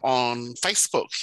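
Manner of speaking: ordinary talk, nothing marked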